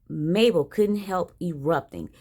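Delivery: ordinary delivery, nothing marked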